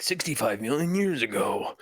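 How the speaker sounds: lightly voiced, low trailer narrator; trailer voiceover. Retelling the Meg trailer to co-host, mock voiceover.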